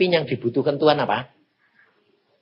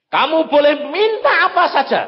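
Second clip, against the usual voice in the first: high, loud proclaiming voice; God's grand offer; 13 semitones higher, 8 dB louder than usual. Retold or imitated. God offering the preacher anything, high and loud.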